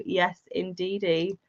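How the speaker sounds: ordinary talk, nothing marked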